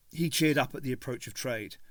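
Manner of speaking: ordinary talk, nothing marked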